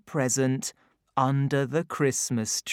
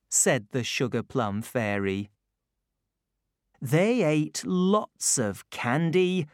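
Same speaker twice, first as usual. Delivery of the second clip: ordinary delivery, nothing marked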